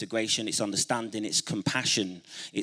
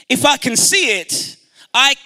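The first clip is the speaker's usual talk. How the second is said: high, loud affirmation; rallying call; 10 semitones higher, 7 dB louder than usual. Real, not acted. Speaker starting an affirmation for the audience, rallying call. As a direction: throw the line out for the crowd to echo. Leads crowd in call and response.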